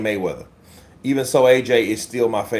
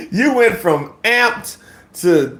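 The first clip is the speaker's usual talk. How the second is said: laughing; 9 semitones higher, slower than usual. Real, not acted. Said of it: "laughing comment".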